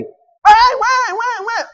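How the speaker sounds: put-on high, loud child's wail for mom; calling for mom. Sick child wailing for his mother, high and loud. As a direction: cry out for mommy over and over.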